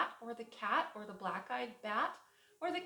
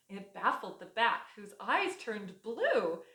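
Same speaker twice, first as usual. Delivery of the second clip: ordinary delivery, nothing marked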